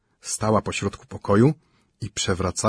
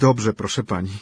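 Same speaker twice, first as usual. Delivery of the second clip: ordinary delivery, nothing marked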